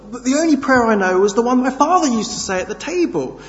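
high, loud and fast, out of breath; breathless panic. Acted. John explaining while fleeing the bull, out of breath. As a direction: gasp it out while running. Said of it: "panted John".

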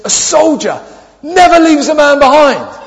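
put-on high, loud, slow earnest boy; solemn child. Four-year-old solemnly quoting Toy Story to dad, high and loud. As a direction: declare it like a tiny soldier hero.

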